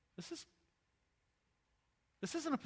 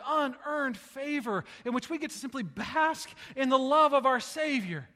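impassioned preaching; 4 semitones higher, 9 dB louder than usual. Acted. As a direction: swell into grace. Preaching build.

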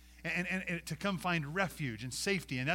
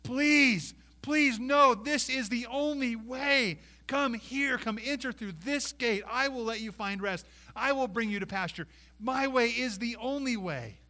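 high, loud, slow plea; Jesus pleading loudly with his listeners; 9 semitones higher, 7 dB louder, slower than usual. Acted. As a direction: beg them to come in, voice straining high. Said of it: "He's pleading with us".

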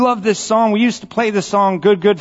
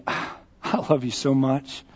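soft, low, tender sigh of love; tender affection; 5 semitones lower, 8 dB quieter than usual. Retold or imitated. Paraphrasing Jesus to his disciples, softly tender.

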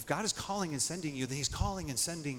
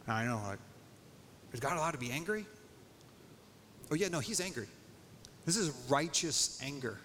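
normal talking voice

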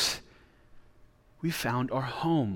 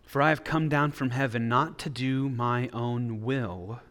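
scripture reading; 3 semitones lower than usual. Acted. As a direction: read the words of Jesus with weight. Reads scripture passage aloud.

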